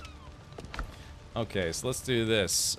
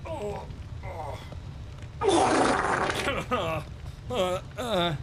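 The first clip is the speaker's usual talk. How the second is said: high strained groans mid-stretch; 8 semitones higher, much slower than usual. Real, not acted. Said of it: "stretching the legs".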